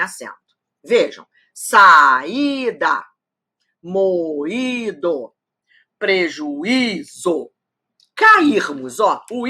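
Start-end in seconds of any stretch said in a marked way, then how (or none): 1.5-3.0 "Saída" stretched out as sa-í-da; louder, drawn-out "Saída" with í standing alone; syllable by syllable. Shown as a demonstration. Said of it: "sozinhos na sílaba". 3.8-5.3 "moído" stretched out as mo-í-do; syllable by syllable. Shown as a demonstration. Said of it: "sozinhos na sílaba". 6.0-7.5 "prejuízo" very slow, pre-ju-í-zo; syllable by syllable. Shown as a demonstration. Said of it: "sozinhos na sílaba".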